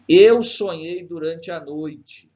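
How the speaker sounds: slow, declaimed line with a loud, high start; verse recitation. Shown as a demonstration. Teacher reading Gonçalves Dias to students, slow recitation. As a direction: launch strongly on "Eu", then measure out the verse. Reads the poem's verse aloud.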